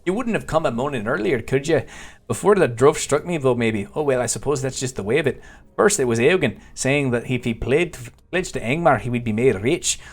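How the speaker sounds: put-on Scottish brogue, storytelling character; lilting amateur Scottish accent. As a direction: read it as a hammed-up Scotsman. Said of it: "I must do my best Scottish accent".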